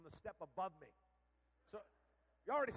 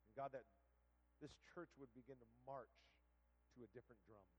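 soft, earnest prayer over the church; pastoral prayer; 3 semitones lower, 4 dB quieter than usual. Acted. Leads closing prayer.

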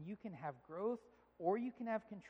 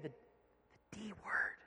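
very slow, secretive whisper; hushed, drawn-out confession; imitated child's whisper; 5 dB quieter, much slower than usual. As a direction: draw it out in a whisper. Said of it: "she whispers".

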